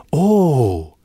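high, loud surprised gasp of oh; a startled little oh. A narrator's reading. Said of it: "on entendit des".

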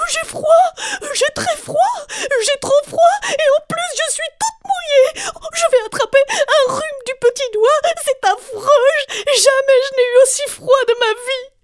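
very high, loud crying wail; crying Galipette. A narrator's reading. Galipette cries after the icy dunk, very high and loud. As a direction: sob and shout from the cold.

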